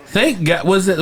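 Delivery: ordinary talk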